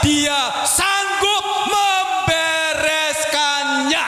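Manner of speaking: high, shouted rallying proclamation; triumphant pulpit cry, drawn out at the climax; full-force preaching. Real, not acted. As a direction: rouse the congregation with a ringing shout.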